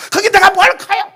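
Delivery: high, whiny complaint; childish protest. Acted. Pastor objects to being the grown-up, high whiny protest. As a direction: whine back like an aggrieved child.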